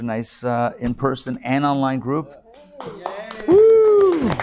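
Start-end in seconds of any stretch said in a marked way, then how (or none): 3.5-4.3 happy whoop. Real, not acted. Cheering the nice group.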